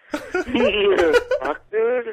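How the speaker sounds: ordinary talk, nothing marked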